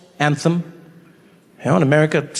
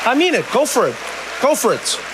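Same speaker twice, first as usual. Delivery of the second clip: cheering on; 6 semitones higher, 3 dB quieter than usual. Real, not acted. Pep talk to kid.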